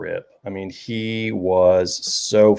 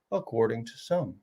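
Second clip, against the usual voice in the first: imitating the teacher, dropping much softer; lowered voice; 12 dB quieter than usual. Teacher lecturing the class, suddenly dropping soft. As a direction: pull it down to a hush mid-lecture. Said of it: "he would raise and lower his voice".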